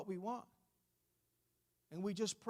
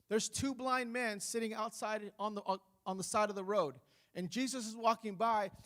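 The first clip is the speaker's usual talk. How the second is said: usual speaking voice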